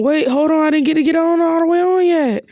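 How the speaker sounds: hammed-up anime cry of alarm; mock yelling. Kiba dangling from the tiger's tail, mock panicked yell. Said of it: "Kiba yelling".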